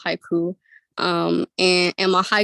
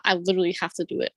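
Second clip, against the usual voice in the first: ordinary delivery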